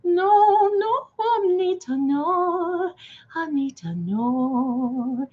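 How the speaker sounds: lightly voiced pleading Maria. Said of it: "Now, this is Maria".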